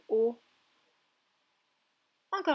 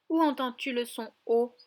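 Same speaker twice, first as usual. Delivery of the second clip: ordinary talk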